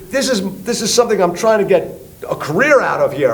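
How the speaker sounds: high, heated protest; retold outraged tirade; indignant rant. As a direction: plead your stake angrily, stumbling at the start. Acted angry rant continues.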